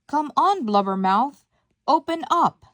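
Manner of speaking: loud, impatient demand with high peaks; yelling. A narrator's reading. Jiminy Cricket banging on the whale's teeth, yelling. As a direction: yell it as an order to open.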